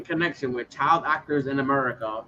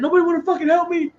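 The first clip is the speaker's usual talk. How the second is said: high, loud, fast imitated plea; hammed-up distraught wailing; mock breakdown; 11 semitones higher, 6 dB louder than usual. Co-host imitating a singer's public breakdown, mock distraught. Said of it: "[name] was having his breakdown".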